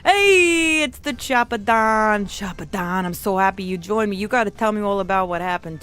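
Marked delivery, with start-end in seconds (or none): 0.1-2.2 loud, high drawn-out greeting; hearty hail. Pretend. Don hailing a friend who joined, loud drawn-out welcome. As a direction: call it out big, stretching the name.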